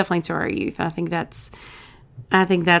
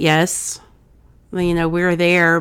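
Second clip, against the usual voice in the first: normal talking voice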